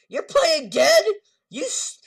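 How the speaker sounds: high shrieking curse, laughing through it; high yell at a prankster friend; furious relieved yell. Retold or imitated. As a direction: yell half laughing, half crying. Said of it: "he starts laughing".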